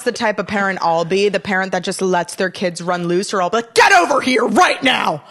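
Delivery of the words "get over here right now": put-on shouted order to a child; mock parental yell. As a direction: play a parent yelling an urgent command. Imagining herself as a yelling parent.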